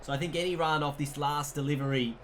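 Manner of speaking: ordinary talk, nothing marked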